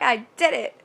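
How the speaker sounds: playful burst of triumph; mock triumph. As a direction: announce it loudly, proud of getting through it. Retold triumph after leaving salon.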